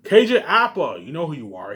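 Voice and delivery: usual speaking voice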